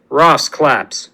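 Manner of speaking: ordinary delivery, nothing marked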